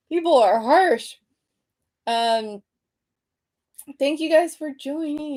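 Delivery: usual speaking voice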